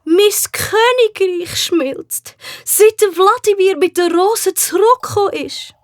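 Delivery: plaintive high queen voice; lamenting queen. A narrator's reading.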